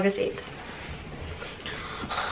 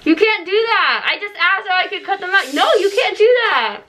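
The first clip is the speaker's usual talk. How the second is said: scolding; 9 semitones higher than usual. Real, not acted. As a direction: tell him off sharply. Sister scolds cheating brother.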